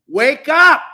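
high, loud shout; real exasperated yell; loud wake-up call. Real, not acted. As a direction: jolt them awake. Urging viewers loudly.

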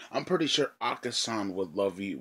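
usual speaking voice

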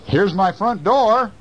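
high proud mouse at his door. Acted. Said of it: "And the mouse said".

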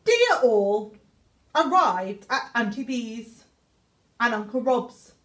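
usual speaking voice

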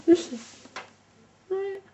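high calling voice. Real, not acted. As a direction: call the dog from afar. Calling the dog again.

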